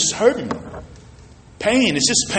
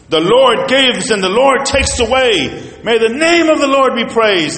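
higher, louder confident praise; faith talking; 6 semitones higher, 3 dB louder than usual. Shown as a demonstration. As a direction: proclaim it with firm faith. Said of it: "So you got faith talking".

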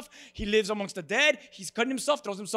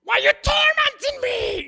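piercing demonic shriek at Jesus; shrieking demoniac; 8 dB louder than usual. Retold or imitated. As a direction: scream it like a possessed man. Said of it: "He shrieked at him".

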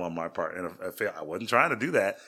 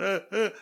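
put-on child's whimper; 12 semitones higher, 5 dB louder than usual. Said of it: "He's going".

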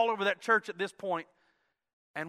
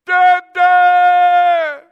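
put-on high, loud, drawn-out child's wail; imitated toddler screaming for his dad; crying little boy; 9 semitones higher, 15 dB louder than usual. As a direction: cry out long and high like a lost child. Crying lost boy sees his father.

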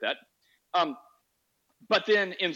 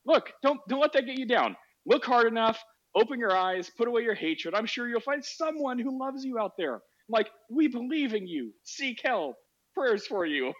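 high, saccharine fake sympathy; troll with sugary, raised-pitch well-wishing; mock encouragement; 7 semitones higher than usual. Host trolls the angry atheist with prayers, mock encouraging.

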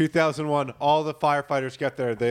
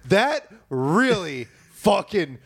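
slow, emphatic indignation; mock seething anger; 3 semitones higher, much slower than usual. Homeowner to firefighter after hearing the news, mock furious. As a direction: stretch each word out, comically furious.

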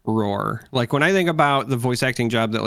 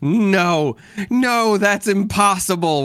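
higher, slow, overacted no; hammed-up denial; 9 semitones higher, 4 dB louder, much slower than usual. Fan imagining the controller's line, hammed-up and drawn out. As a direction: play the doomed man clinging on, hamming it. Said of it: "I wanted them to say".

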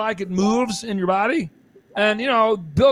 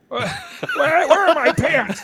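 high, laughing, slowed where are my pants; laughing mock confusion; 8 semitones higher, slower than usual. Mocking a dazed stoner, laughing. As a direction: giggle through a dazed stoner line. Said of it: "That was the best part".

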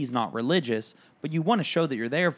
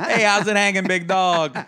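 loud, high buddy-style holler; hammed-up backslapping hello; hearty greeting; 6 semitones higher, 7 dB louder than usual. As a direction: hail an old pal at full volume. Mock inappropriate greeting at a wake.

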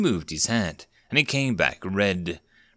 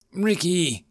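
wheezing; 8 semitones higher than usual. Acted. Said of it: "Alex wheezed as he spoke".

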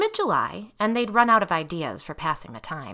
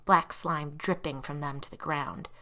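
plain reading voice

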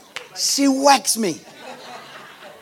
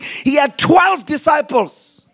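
full-force preaching; 3 semitones higher than usual. Acted. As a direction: shout the promise like a pastor at full force.

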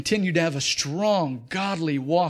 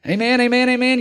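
preaching amen; 7 semitones higher, 7 dB louder than usual. Acted. Exhorting congregation.

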